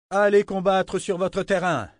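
harangue haute et forte; roaring rallying speech. Acted. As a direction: lance l'ordre comme un cri de ralliement. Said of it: "Des vivates saluèrent cette harangue". (harangue de ralliement tonnante)

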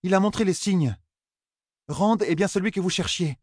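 high-pitched woman character in a tale; high, even storybook dialogue. Acted. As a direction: lift the voice well up for the character's reply.

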